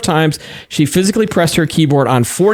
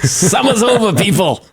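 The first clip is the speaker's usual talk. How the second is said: put-on high laughing Boston accent; 7 semitones higher than usual. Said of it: "That's my Boston accent for you".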